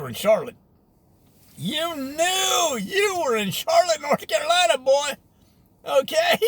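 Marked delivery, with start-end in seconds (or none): 1.6-6.4 proud hometown shout; emphatic shout. Real, not acted. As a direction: belt it out with hometown pride. Emphatic nostalgic outburst.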